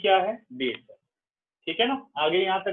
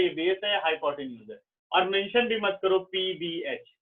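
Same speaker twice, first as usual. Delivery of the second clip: ordinary delivery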